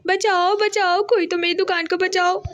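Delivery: lightly acted panicked shopkeeper's plea; mock shouting for help. A narrator's reading. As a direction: call out for rescue in a contained storybook yell. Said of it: "जोर जोर से चिल्लाने लगता है".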